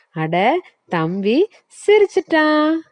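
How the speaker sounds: high, quick delighted cartoon voice; vegetables cheering. Vegetables cheer that the boy laughed, high delighted voice. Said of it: "எல்லா காய்கறிகளும் சொல்லுது".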